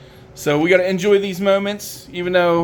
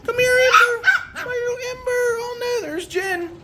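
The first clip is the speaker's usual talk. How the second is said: much higher doting coo to the pups. Real, not acted. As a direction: gush over the little puppies. High baby talk to puppies.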